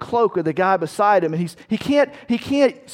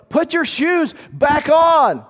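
slow, drawn-out shout, much higher and louder; yelling at the top of lungs; 9 semitones higher, 6 dB louder, slower than usual. Retold or imitated. Soldier screaming to a comrade over battle noise, slow high shout. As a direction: bellow it across the ranks. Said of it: "yelling at the top of your lungs".

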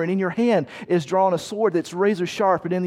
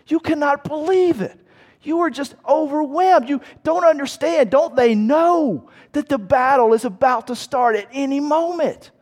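much higher, louder incredulous outburst; disbelief; 8 semitones higher, 3 dB louder than usual. Acted. Preacher voicing a soldier's shock at deserters, high incredulous. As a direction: sound overwhelmed and baffled.

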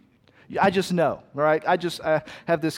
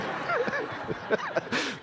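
put-on child's giggle; 9 dB quieter than usual. Child's happy laugh voiced.

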